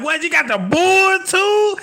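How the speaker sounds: high, slow incredulous what; mock disbelief. Challenging a Bulls fan, high and incredulous.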